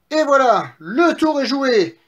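high, loud, triumphant piglet voice; satisfied piglets. Acted. Three pigs celebrate trapping the wolf, satisfied. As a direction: crow over the finished trick. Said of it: "dirent les trois petits cochons satisfaits".

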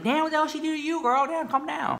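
high squeaky damn, calm down; high incredulous squeal. Retold or imitated. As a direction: squeal in shocked disbelief. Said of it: "I'm just like".

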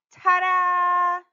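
high, loud, held-out fanfare; sung-out, proud ta-da; triumphant ta-da. Acted. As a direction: show off the big finish. Triumphant finish to a cartwheel.

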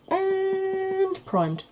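high, slow singsong. Real, not acted. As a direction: draw out the last step playfully. Playful list of prep steps.

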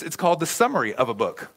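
normal talking voice

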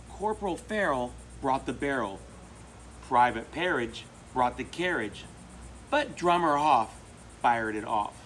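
rhythmic picture-book read-aloud; reading to children. A narrator's reading. Reader sharing a favorite book with children, rhythmic storytelling. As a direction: read the cumulative rhyme to kids, steady beat.

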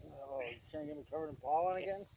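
ordinary talk, nothing marked

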